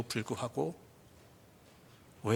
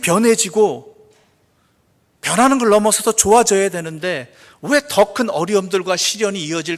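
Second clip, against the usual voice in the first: raised, louder questioning from the pulpit; impassioned preaching; 7 semitones higher, 12 dB louder than usual. Real, not acted. Preacher asking why God's answer is hardship, impassioned preaching. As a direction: press the question with force.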